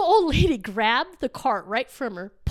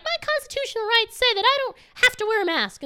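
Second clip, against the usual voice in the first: high-pitched, put-on entitled protest; mock indignant protest; 10 semitones higher than usual. Woman arguing over store mask rule, mock indignant. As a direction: imitate an outraged customer, pitched high.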